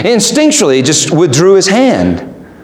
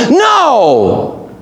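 emphatic no; 5 semitones higher than usual. Real, not acted. Rejecting the list.

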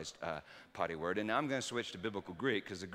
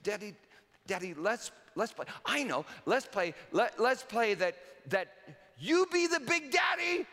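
put-on higher child voice, building to a squealing shout; little girl pleading; 13 semitones higher, 7 dB louder than usual. Little daughter begging her dad to play, rising to an excited shout. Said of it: "She said".

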